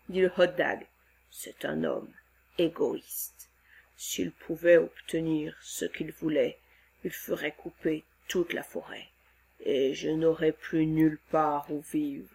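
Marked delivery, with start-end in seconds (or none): 1.3-12.3 much lower, quiet creature lament; lower, soft sad monster. Acted. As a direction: grieve for his forest home.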